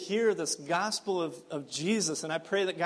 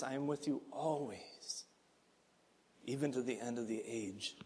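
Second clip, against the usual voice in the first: quieter, falling away into near hush; hushed repetition; 5 semitones lower, 10 dB quieter than usual. Shown as a demonstration. Pastor repeating the promise to the church, hushed. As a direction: say it again almost under the breath. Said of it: "I pray that those words would echo".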